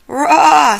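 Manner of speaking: Scooby's frightened yowl; Scooby scream. Acted. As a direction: yelp like a scared dog. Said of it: "Scooby echoed".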